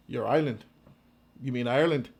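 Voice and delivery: lightly voiced sober straight man; understated Wallace reply. Wallace questioning the crazy Irishman, understated disbelief.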